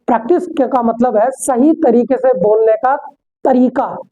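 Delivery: normal talking voice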